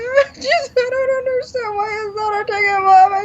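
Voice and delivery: high, teary retelling of a phone call to mom; crying on the phone, recreated; retold crying. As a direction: imitate herself sobbing to her mother, pitched high. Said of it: "And I'm crying on the phone".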